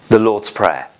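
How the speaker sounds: ordinary delivery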